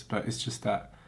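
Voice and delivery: normal talking voice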